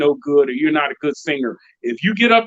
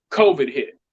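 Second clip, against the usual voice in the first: ordinary talk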